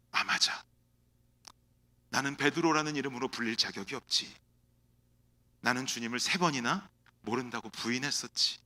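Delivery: low, soft self-reproach; guilty inner voice. Acted. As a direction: murmur it to yourself in shame. Peter's inner guilty monologue. (낮고 작게 자책하는 속마음)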